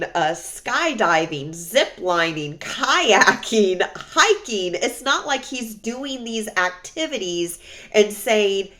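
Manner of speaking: loud, emphatic venting; exasperated rant. Real, not acted. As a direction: rant loudly, punching out the list and the mock invitations. Venting about ex's bar invitations.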